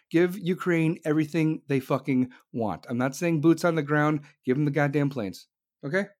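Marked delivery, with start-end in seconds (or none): none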